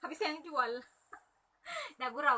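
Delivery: words broken by laughter; laughing through words. Real, not acted. Seller joking with viewers, laughing as she talks. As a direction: chuckle your way through the line.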